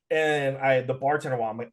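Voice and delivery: ordinary talk, nothing marked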